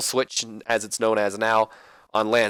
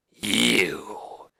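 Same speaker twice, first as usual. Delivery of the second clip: McMahon voice; 3 semitones lower, 3 dB quieter than usual. Retold or imitated. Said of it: "McMahon's like".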